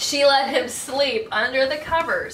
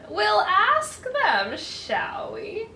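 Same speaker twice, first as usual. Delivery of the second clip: slow, higher devilish fox purr; 7 semitones higher, slower than usual. Acted. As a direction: grin as he plans to eat the dog. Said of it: "very devilish grin".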